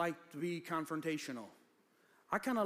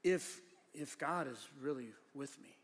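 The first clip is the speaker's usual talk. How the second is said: lower, hesitant, doubting reading; doubtful question; 3 semitones lower than usual. Shown as a demonstration. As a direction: read it as an unsure question, with halting pauses. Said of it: "if you read it as a question".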